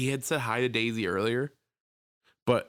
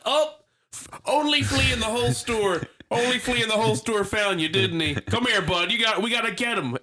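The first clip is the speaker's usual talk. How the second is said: put-on much higher, louder cooing old man; old man impression; 10 semitones higher, 4 dB louder than usual. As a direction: sweet-talk the dog in a high old-man voice. Old man making up the flea story.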